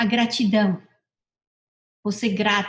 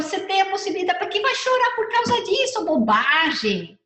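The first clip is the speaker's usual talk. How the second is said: higher, mildly dismissive motherly scolding; retold scolding mom; 9 semitones higher than usual. Mother shrugging off her crying daughter, higher mock scold. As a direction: brush off the child's tears lightly. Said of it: "Eu falo".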